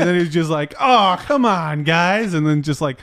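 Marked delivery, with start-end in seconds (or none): 0.8-2.3 high, drawn-out whiny plea; mock protest. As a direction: imitate a busted suspect protesting slowly. Retold suspect caught by police.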